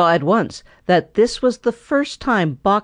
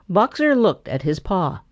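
usual speaking voice

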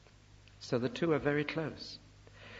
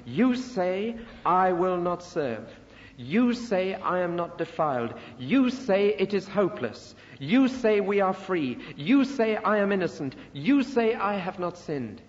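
raised, accusatory preaching; quoting people's answers; 5 semitones higher, 4 dB louder than usual. Acted. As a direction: hurl their own answers back at them, "you say" pitched high. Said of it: "quotes the people's answers".

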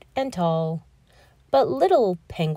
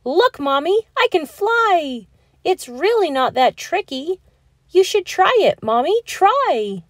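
a much higher, louder, gleeful little voice; excited child; 8 semitones higher, 5 dB louder than usual. Acted. Pip-Pip calls down to mommy while flying, gleeful. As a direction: show mommy you can fly.

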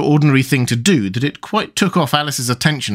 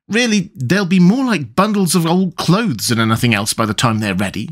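higher-pitched, lively aside; lightly voiced girl, pitched up then settling; exasperated Alice; 6 semitones higher than usual. A narrator's reading. Alice remarking to herself, lightly exasperated.

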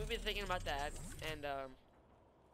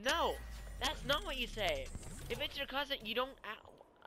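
much higher and louder, slowed, indignant; retold exasperation, pitched up and slowed; incredulous inner protest; 8 semitones higher, 3 dB louder, slower than usual. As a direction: push back like he just said something absurd. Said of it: "I'm sitting there thinking".

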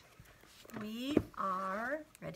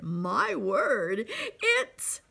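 high, stammering excitement building louder; amazed shouting; 16 dB louder than usual. A narrator's reading. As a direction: burst out with the astonishing discovery. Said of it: "they shouted".

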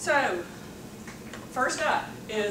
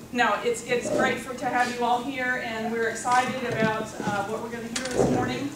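usual speaking voice

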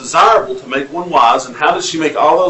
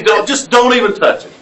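laughing warning; 7 semitones higher than usual. Retold or imitated. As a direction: scold playfully while laughing. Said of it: "I say".

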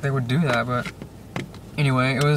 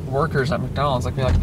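usual speaking voice

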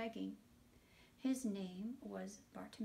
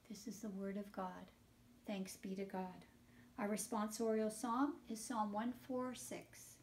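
usual speaking voice

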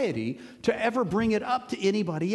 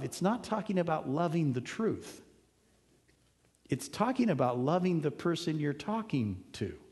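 ordinary delivery

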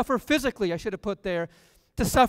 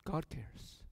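almost a whisper, far softer; hushed; 4 semitones lower, 15 dB quieter than usual. Real, not acted. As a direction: let it fall to a hush. Hushed answer after contrast.

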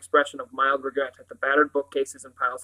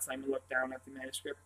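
ordinary delivery

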